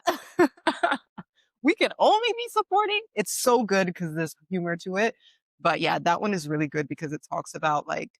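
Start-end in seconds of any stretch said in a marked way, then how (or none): 0.1-3.0 high, incredulous squeal of disbelief; animated retelling of Taraji's outrage. Retold or imitated. Friend retelling an actress's indignant joke, squealing. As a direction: pitch climbing way up in mock outrage. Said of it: "Taraji said".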